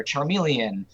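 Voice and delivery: usual speaking voice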